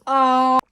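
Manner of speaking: sudden loud squeal of delight; loud happy shriek; excited squeal. Real, not acted. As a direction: burst out with a shriek of joy. Squeal at book reveal.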